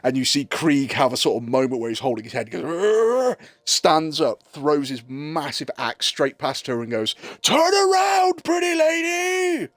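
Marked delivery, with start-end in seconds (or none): 2.6-3.4 frustrated, high-pitched growl; berserker growl. Acted. Berserker clutches head, acted growl. 7.4-9.7 very high, slow, drawn-out scream; berserker shout. Acted. Berserker yells warning.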